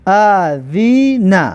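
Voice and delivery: very slow, drawn-out syllables; slow teacher's model reading. Shown as a demonstration. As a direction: stretch each syllable for learners to copy. Teacher models the joined word.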